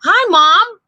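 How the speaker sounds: high, loud happy hello; excited greeting. A narrator's reading. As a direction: call out gladly to mom on screen. Kids greet mom on video call.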